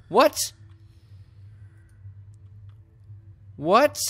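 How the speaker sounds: high, loud disbelieving cry; can't believe what just happened; shocked what. Real, not acted. Player stunned by a twist, yelping in disbelief.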